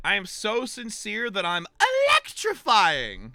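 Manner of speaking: loud, high, drawn-out punchline; hammed-up showmanship. Streamer punning on Electrical's name, hammed-up showmanship. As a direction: stretch the word out like a showman.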